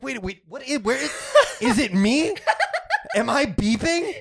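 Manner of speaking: high, puzzled and laughing; panicked high voice, half laughing; confused panic. Retold or imitated. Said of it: "And she's like".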